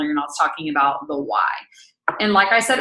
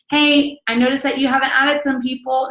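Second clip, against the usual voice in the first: higher-pitched pretend check-in; put-on higher voice of a nudging upline; mock upline nudge; 6 semitones higher, 3 dB louder than usual. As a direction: imitate a chiding team leader.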